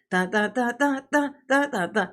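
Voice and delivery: hummed dramatic dun dun dun; sung fanfare. Pretend. Streamer teases the clip for viewers with a hummed fanfare. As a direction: drumroll tease before the big reveal. Building suspense before playing clip.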